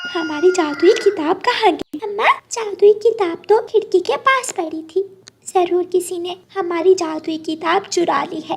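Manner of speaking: loud, high alarmed exclamation; alarmed cry. Acted. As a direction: birds find the magic book missing, loud and alarmed. Birds discover book stolen.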